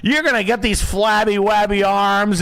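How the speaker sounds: high, loud, slow, stretched-out gushing; Richard Simmons. Retold or imitated. Fitness celebrity greeting a biker, exaggerated high gushing. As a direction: gush in a hammed-up, high-pitched squeal. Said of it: "he was like this".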